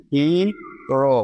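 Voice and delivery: হিরো drawn out slowly as two syllables, হি and রো; split into syllables. Shown as a demonstration. Teacher showing students two syllables, হিরো slowly split. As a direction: say হিরো very slowly, pausing between হি and রো. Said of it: "এখানে কিন্তু দুটি syllable আছে".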